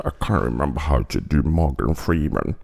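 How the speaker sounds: very low, measured film-star voice; Morgan Freeman. Acted. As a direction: drop right down into a deep impression. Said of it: "if you do the Morgan Freeman impression".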